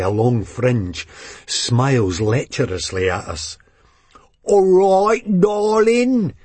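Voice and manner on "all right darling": much higher, louder mock Cockney toff; put-on Cockney. A narrator's reading. Rich creep chats up waitress in fake Cockney. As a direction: fake Cockney chat-up line. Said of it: "he says in a put-on Cockney accent".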